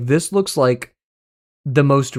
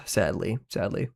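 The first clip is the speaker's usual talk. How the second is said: much lower, softer resigned sadly; mock sad; 6 semitones lower, 9 dB quieter than usual. Real, not acted. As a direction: let it drop in mock mourning. Resigned at the vote outcome.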